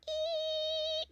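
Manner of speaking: high thin mock "ee" of a whining hearing aid; hearing aid whine. Imitating the hearing aids whining during the old folks' service, high "ee". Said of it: "from the hearing aids whining".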